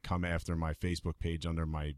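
normal talking voice

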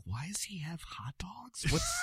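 whispered, slowed-down confused aside; puzzled whisper. Retold or imitated. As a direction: hush it like a baffled crew member. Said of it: "some of the people on set were like".

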